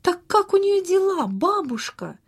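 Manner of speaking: acted impatient children, pitch jumping up. A narrator's reading. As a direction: press grandma eagerly for an answer. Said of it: "нетерпеливо спросили ребята".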